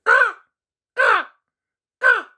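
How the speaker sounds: high, loud cawing crow calls; crow caws. A narrator's reading. Crows cawing in the barren woods, high loud caws. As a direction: caw like a crow in dark woods. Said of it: "mostly just crows cawing".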